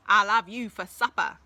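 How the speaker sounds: loud, menacing troll voice; threatening troll. Acted. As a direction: boom it out to scare him.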